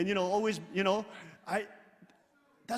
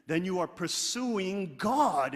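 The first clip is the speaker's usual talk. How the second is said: ordinary talk, nothing marked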